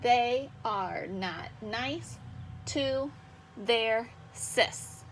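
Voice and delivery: very slow, evenly separated beats; measured syllable by syllable. Shown as a demonstration. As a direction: count each syllable as you say it. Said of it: "I'm gonna measure it".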